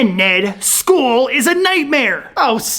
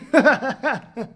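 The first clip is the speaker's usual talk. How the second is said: laughing; 3 dB quieter than usual. Real, not acted. Boys laugh at impression.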